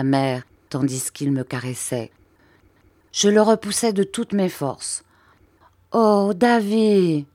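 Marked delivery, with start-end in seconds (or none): 5.9-7.3 slow, high startled cry; a mother's dismayed exclamation; cried out. A narrator's reading. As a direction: call his name out in dismay. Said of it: "s'écria ma mère".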